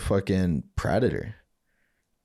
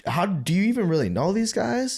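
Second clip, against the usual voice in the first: high incredulous question; 11 semitones higher than usual. Retold or imitated. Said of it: "I was like".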